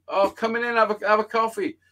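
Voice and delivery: high-pitched voice inviting someone in; imitated placating host. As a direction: mimic a friend's missus being hospitable. Voices the missus inviting him in.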